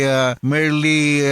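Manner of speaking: ordinary talk, nothing marked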